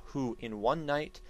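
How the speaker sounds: ordinary delivery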